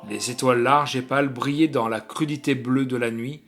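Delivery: ordinary delivery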